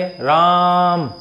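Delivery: stretched long vowel in राम, calling someone far away; drawn-out call. Shown as a demonstration. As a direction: call राम with the आ held three times as long. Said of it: "कैसे बुलाएंगे?".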